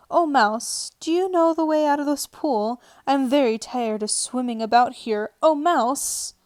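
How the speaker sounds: high, loud formal call to a mouse; loud, lifted cry of "Oh, mouse". Acted. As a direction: call out grandly across the water. Alice calling out to mouse.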